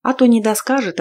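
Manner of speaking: ordinary delivery, nothing marked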